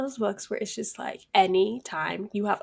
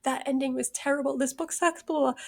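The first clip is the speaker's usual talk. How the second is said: mock complaint; 5 semitones higher than usual. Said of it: "I keep being like".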